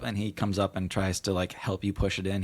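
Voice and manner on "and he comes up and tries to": ordinary delivery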